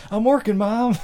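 high-pitched whine to Mom; hammed-up embarrassed complaint; mock exasperated son. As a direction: protest like a son interrupted by his mother. Acted son protesting to mom.

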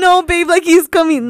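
loud, high panicked insistence; urgent, frightened pleading. Retold or imitated. Woman to boyfriend as a stranger approaches, panicked and loud.

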